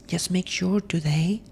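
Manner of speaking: ordinary talk, nothing marked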